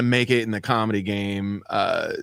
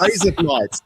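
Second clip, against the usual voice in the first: Australian-sounding razor blades; 4 semitones higher than usual. Shown as a demonstration. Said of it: "for an Australian".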